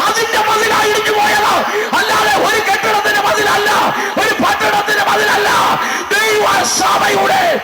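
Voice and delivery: fiery preacher proclaiming to the church; preaching at full force. Acted. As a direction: build the sermon to a ringing peak.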